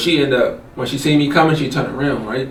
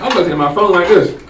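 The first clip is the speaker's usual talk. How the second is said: ordinary delivery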